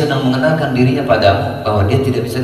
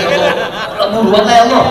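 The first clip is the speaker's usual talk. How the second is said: mock impatient prayer; 8 semitones higher than usual.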